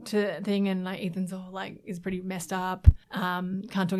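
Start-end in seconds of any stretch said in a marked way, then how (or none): none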